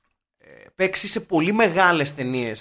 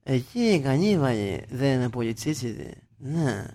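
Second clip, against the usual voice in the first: scheming film producers; 4 semitones lower than usual. Acted. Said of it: "και λέγανε".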